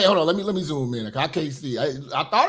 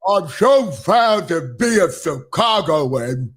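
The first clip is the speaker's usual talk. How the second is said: lightly mimicked proud Chicagoan, low and slow; mock interviewee; 6 semitones lower, slower than usual. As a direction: draw it out slowly in a mocking echo of her. Mimics the interviewed woman's words.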